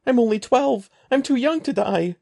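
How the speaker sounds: high, loud, panicked screaming; terrified scream. A narrator's reading. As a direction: scream for your life. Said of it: "Evan screamed".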